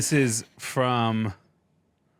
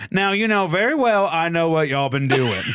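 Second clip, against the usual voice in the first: put-on full southern accent; 7 semitones higher, 4 dB louder than usual. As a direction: announce it like a southern queen. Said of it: "continued his resonant announcement in full southern".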